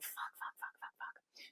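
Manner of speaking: rapid whispered curses to herself; panicked whisper under the breath; whispered swearing. Retold or imitated.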